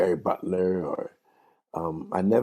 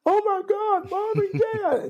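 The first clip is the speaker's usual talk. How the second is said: very high swooning shriek; imitated fan scream; slower than usual. Son imitates women screaming at [name], high shriek. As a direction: squeal like a star-struck teenager. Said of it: "And they were screaming".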